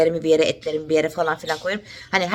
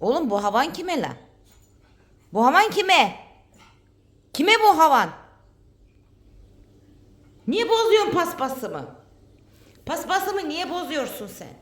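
playful scolding; 10 semitones higher than usual. Real, not acted.

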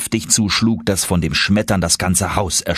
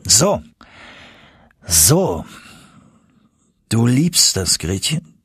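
very serious; 2 semitones higher, slower than usual. A narrator's reading. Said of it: "fragte mein Onkel sehr ernst".

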